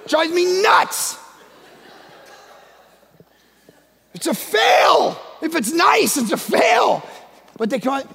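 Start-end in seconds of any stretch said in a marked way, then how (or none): 0.1-7.0 exasperated pulpit shout; frustrated shout. Real, not acted. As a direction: pound out each word. Frustrated preacher.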